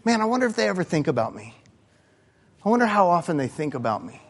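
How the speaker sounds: put-on a lovesick young person wondering, softly; imagined lover's private wondering; wistful inner thought. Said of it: "do you remember thinking".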